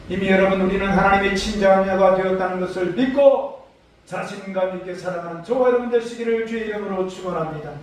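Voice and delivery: ordinary talk, nothing marked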